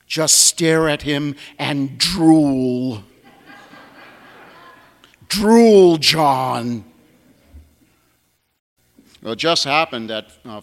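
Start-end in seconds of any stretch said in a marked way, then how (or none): 0.1-3.0 slow, chuckling delivery; laughing through it. Retold or imitated. Joking advice to sick priest. 5.3-6.9 a much louder, insistent "Drool"; emphatic repeat. Retold or imitated. Repeating the joking advice.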